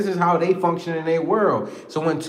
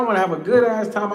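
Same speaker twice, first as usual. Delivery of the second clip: ordinary delivery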